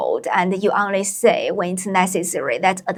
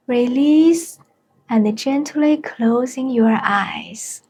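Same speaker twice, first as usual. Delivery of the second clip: unhurried meditation guide with long pauses; spacious, measured mindfulness voice; guided meditation. Acted. Leads a short mindfulness meditation.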